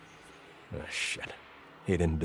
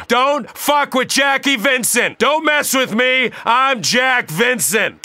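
angry shout; 8 semitones higher, slower than usual. Acted. Combat taunt at enemy.

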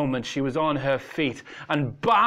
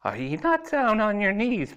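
haughty; 9 semitones higher than usual. A narrator's reading. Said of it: "her expression now haughty and formidable".

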